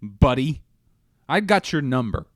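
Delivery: hammed-up stern talking-to; confronting the driver. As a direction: call out the bus driver with bravado. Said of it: "I'd say".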